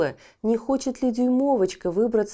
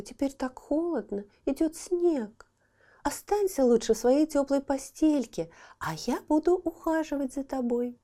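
put-on gentle girl voice; 7 semitones higher than usual. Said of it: "сказала Дюймовочка".

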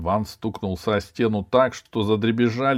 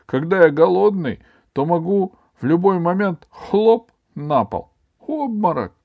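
high, loud whining schoolboy; overdone hungry-boy lament; plaintive; 10 semitones higher, 7 dB louder than usual. A narrator's reading. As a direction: complain pitifully, pitched well up. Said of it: "жалобно сказал Иван".

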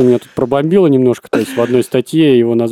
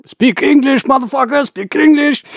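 much higher furious yell; angry shout. Retold or imitated. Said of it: "начинает там бучу устраивать".

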